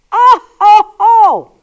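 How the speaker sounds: loud, high scoffing exclamation; big, high "oh, ho, ho" of disbelief; mock amazement. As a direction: hoot at a ridiculous idea. Scoffs at getting life insurance.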